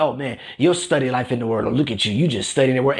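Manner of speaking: low-pitched mock compliment; mock admirer. Imagined onlooker praising someone's Bible study, mock-admiring and low. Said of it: "No one's gonna comment".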